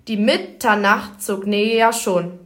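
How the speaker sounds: slow, every second syllable stressed; iambic stress. Shown as a demonstration. Presenter demonstrating the iambus to listeners, slow beat. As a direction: tap out the da-DUM da-DUM beat. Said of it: "Hierbei liegt die Betonung auf der zweiten Silbe".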